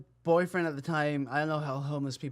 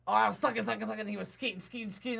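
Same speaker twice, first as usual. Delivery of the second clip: imitated woman's rambling; 4 semitones higher, 4 dB quieter than usual. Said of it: "Be like".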